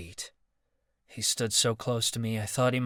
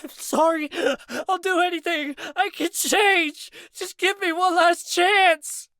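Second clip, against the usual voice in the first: much higher, louder blubbering plea; sobbing plea; 7 dB louder than usual. Acted. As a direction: beg through sobs. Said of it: "Sobs broke from Marcus's chest".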